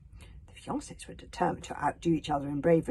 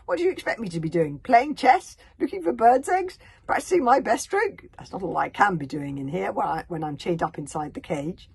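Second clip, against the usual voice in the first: sarcastic; 10 dB louder than usual. Acted. Hiccup mocks his captor.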